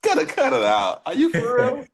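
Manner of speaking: high, loud disbelief; can't believe what he just heard; surprised. Real, not acted. Dad hears son ranked second nationally.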